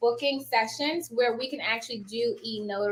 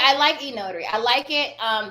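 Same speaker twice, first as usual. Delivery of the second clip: ordinary talk, nothing marked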